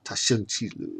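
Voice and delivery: usual speaking voice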